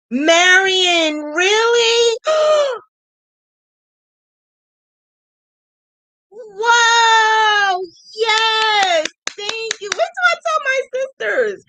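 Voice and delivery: high, squealing thanks; excited squeal. Real, not acted. Thrilled squeal, thanking viewer Marion for a donation. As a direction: gush with delight at the donation.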